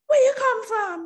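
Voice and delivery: high, loud patois question of a schoolchild; curious Jamaican kid. Retold or imitated. Schoolkids quizzing the new girl, high and curious. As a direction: play a nosy Jamaican child. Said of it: "the Jamaican kids were like".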